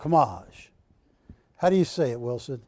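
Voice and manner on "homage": homage in one quick burst, huh-MAHZH. Shown as a demonstration. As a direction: rush homage, first syllable barely there. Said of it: "do you say it quick".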